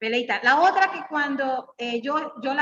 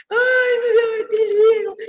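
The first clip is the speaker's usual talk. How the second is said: much higher and louder, sobbing lament; crying; 9 semitones higher, 8 dB louder, slower than usual. Retold or imitated. Preacher relives her crying over the vitiligo news, sobbing. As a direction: wail it as if the news just came. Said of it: "yo llorando".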